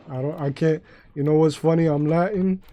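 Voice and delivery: ordinary talk, nothing marked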